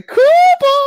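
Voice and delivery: high, loud, drawn-out shout; called-out name. Shown as a demonstration. Showing co-host the catchphrase, called-out name. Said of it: "That's what we're gonna say".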